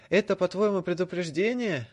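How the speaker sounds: loud, high furious yell; shouting. A narrator's reading. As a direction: scream the question. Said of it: "Драко уже кричал".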